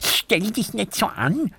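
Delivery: put-on high childlike voice, defensive; a small hedgehog sticking up for himself; high hedgehog voice, a bit huffy; little hedgehog. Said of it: "sagt der kleine Igel".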